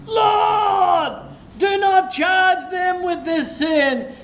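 high, slow crying-out prayer, a touch of it. Retold or imitated. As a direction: cry it out to the Lord, high and drawn out. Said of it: "he was crying out here".

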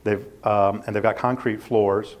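ordinary talk

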